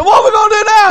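high, loud, raring to go; eager question. Retold or imitated. Song leader asking the preacher what's next, eager and loud. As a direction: ask it bursting with eagerness. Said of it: "He said".

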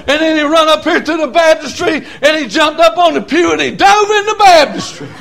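high holler climbing to a peak; fired-up country preacher; full-force preaching. Real, not acted. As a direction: build excitedly to a shouted climax.

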